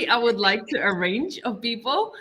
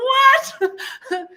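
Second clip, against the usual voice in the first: shocked what; 14 semitones higher, 5 dB louder than usual. Retold or imitated. Surprised reaction in retold meeting.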